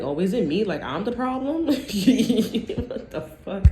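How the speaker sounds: rising, laughing disbelief; incredulous. Acted. Reader playing the person asked if they're the problem, incredulous.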